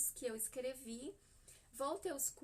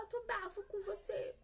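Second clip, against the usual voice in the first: put-on sulky little boy; 8 semitones higher than usual. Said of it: "Ele fala".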